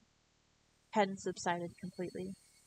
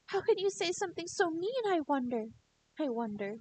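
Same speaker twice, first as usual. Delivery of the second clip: much higher, mock-wounded girl voice; coy hurt Rena; 9 semitones higher than usual. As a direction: pout at him in a high girlish voice.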